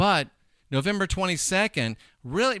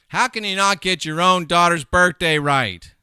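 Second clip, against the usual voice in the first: raised, louder, exasperated demand; loud disbelief; 4 semitones higher, 9 dB louder than usual. Real, not acted. Host scolding a parent over a daughter's birthday, loud disbelief.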